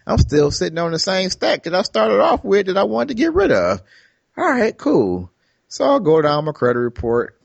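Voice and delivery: high, mock-cheerful sarcasm; sarcastic self-quote. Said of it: "I'm like".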